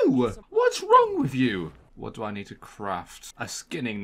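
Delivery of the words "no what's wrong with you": high, slow, appalled cry; shocked outrage. Real, not acted. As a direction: recoil in disbelief. Boar recipe shock.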